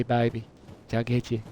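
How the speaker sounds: ordinary delivery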